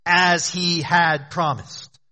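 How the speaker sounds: as stressed, the phrase slowed and drawn out; as he had promised, slow and emphatic. Shown as a demonstration. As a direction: press on as to show God kept his word. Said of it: "You hear the emphasis?".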